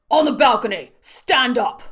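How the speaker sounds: loud barked order; shouted command. A narrator's reading. Guard shouts orders up to the balcony, loud. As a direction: yell up at the balcony.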